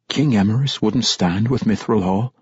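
usual speaking voice

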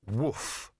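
soft, odd dog voice saying woof. A narrator's reading. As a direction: let the dog speak the word woof softly. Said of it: "It had said".

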